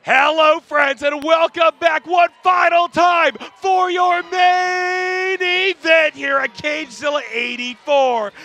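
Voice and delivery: high, loud, slow hype commentary; frantic mock sports commentary. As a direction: shout the welcome like live TV. Commentator opens main event.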